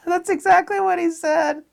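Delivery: squealing laughter through the words; laughing high. Real, not acted. Retelling the worker's insult, laughing high. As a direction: laugh high while saying it.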